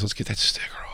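unvoiced hushed whisper. Real, not acted. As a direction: whisper so he can't hear. Hiding from Jacob, whispering.

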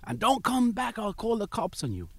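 mock angry threat, pitched high; high-voiced warning, not shouted; retold fuming priest. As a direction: imitate an irate priest sending someone packing. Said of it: "he got fuming".

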